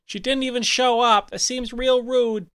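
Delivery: high, fussy auntie voice; Aunt Susan. Acted. Aunt telling off Grandma Gertie on the phone, scolding. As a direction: scold in a put-on high-pitched voice.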